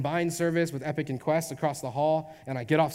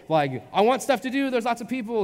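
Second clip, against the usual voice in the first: high, louder imitation of an excited baby; high-energy baby; 7 semitones higher, 5 dB louder than usual. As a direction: play the hyped-up little extrovert. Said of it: "high-energy baby, is like".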